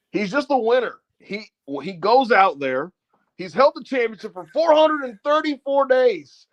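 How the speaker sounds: high, emphatic hype commentary; wrestling commentator. Retold or imitated. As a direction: call it high and drawn out, hyping the champion. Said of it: "he is commentating for Punk".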